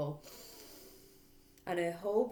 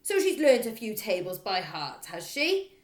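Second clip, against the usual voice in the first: loud sneering bark; scornful bark; 4 semitones higher, 10 dB louder than usual. Acted. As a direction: bark it mockingly. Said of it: "Miss Trunchbull barked".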